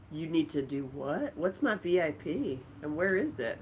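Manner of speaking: usual speaking voice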